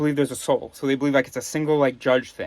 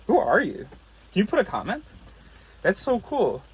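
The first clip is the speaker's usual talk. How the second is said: higher, eager vlogger voice; YouTuber voice; 7 semitones higher than usual. Pretend. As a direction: talk to the viewers like an upbeat YouTuber. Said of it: "I'm doing, like, a YouTuber voice".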